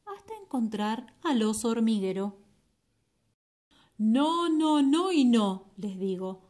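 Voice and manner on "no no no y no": loud emphatic refusal. Acted. Anteater refuses loudly.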